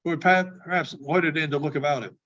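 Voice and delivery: usual speaking voice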